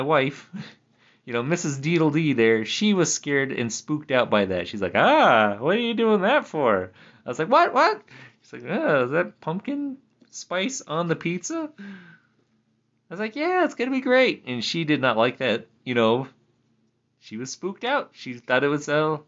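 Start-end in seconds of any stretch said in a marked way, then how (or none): 4.9-6.9 louder, higher mock alarm; wife shocked at pumpkin spice pizza, mock alarm; imitating startled wife. 7.5-8.0 very high, loud what, what; husband playing dumb to his wife, squeaky surprise; mock innocent surprise. 8.7-11.7 slow, higher doubtful question; wife eyeing the pizza, slow mock suspicion; imitating wife. Wife asks, disgusted. 13.3-14.4 very high, cheery promise; husband defending his pizza, exaggerated cheer; mock enthusiastic.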